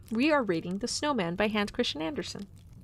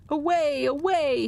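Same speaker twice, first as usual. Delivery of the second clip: loud barking dog cry; barked; 6 semitones higher, 5 dB louder than usual. A narrator's reading. The yard dog barking at the snowman, loud. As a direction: bark it out like a dog. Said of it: "he barked".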